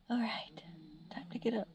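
soft whisper to yourself on waking; sleepy whisper. Real, not acted. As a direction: whisper it, barely voiced. Just woken, talking to self.

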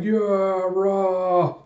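high, drawn-out cheer; rallying cheer. Retold or imitated. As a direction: cheer like a crew leader rallying men. Yakuza leader's rallying cheer.

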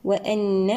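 "وأن" with a long nasal doubled n; full ghunna. Shown as a demonstration. Tajweed teacher showing complete ghunna, held n in "وأن". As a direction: hum and hold the n in "وأن". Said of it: "نأتي بغنة النون المشددة أكمل ما تكون".

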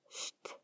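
St as a bare voiceless "sht"; no E before ST. Shown as a demonstration. Teacher warning learners never to add an E, voiceless "sht" for St. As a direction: say St as "sht" with no vowel in front. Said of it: "nunca se pronuncia una E".

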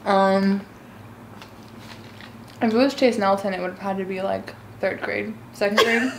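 talking while chewing donut; muffled words around food; mouth full, chewing. Real, not acted. Seen 0: Bites donut at -1.0, then chews with head tilted while talking.

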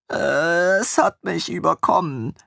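put-on high, sheepish young man's voice; ashamed. A narrator's reading. Caught out, Armin meekly confesses to his former lord in a high voice. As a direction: admit it meekly, embarrassed. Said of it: "meinte er beschämt".

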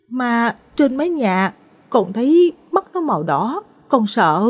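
put-on high-pitched, frightened child's voice; a scared little boy's tearful whine; whimpering. A narrator's reading. Small boy clinging to father describes red eyes, whimpering. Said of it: "Thằng bé sợ hãi ôm lấy cổ bố khóc thút thít".

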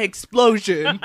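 slow loud cry through laughter; loud laughing shout. Pretend. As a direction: blurt it out laughing. Loud punchline while laughing.

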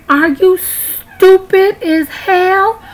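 high, slow, mocking scorn; scornful disbelief. Real, not acted. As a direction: talk down to the friend, incredulous. Insulting a non-voting friend.

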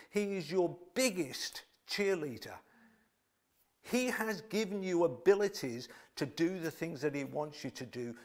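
ordinary delivery

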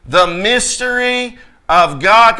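slow, loud, measured read-aloud; leading congregational reading. Real, not acted. Preacher leads the congregation in reading scripture aloud, slow and emphatic. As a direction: stretch each word so all can join. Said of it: "everybody read this with me".

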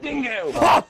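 high, sudden yell of Dingo; excited yell. Real, not acted. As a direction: yelp it out in the heat of the fight. Gamer yelling at kill.

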